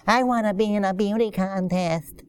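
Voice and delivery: soft put-on little-kid voice; five-year-old child. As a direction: mimic a small child making a wish. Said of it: "Five-year-old didn't call up and said".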